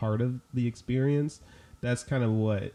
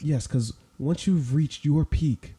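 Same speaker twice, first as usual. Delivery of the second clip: therapist voice; 3 semitones higher than usual. Pretend. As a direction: play the calm therapist explaining a dream. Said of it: "we gotta pull out the therapist voice".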